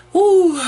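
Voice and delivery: sudden high loud whoop; a high shout of frustration; exasperated whoop. Real, not acted. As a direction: let out a loud exasperated woo. Exasperated outburst after rant.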